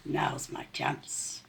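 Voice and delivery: soft, slow sneaky rat; sly whisper. A narrator's reading. Rat creeping up on sleeping chickens, hushed. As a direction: hush it so the chickens stay asleep.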